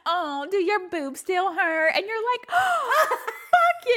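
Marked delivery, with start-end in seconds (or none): 0.1-1.9 high, slow taunt with a laugh; mock teasing. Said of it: "What if he was teasing you". 2.5-3.9 high, laughing mock outrage; mock offended retort. Imagined offended retort to teasing.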